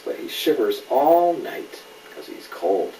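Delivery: ordinary delivery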